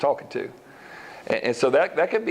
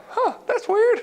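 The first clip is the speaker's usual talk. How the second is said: much higher, squeaky, baffled "huh"; puzzled surprise; slower than usual. Acted. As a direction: play a confused Philistine finding the toppled idol. Philistines find fallen statue.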